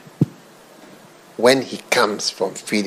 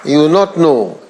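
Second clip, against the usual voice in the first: normal talking voice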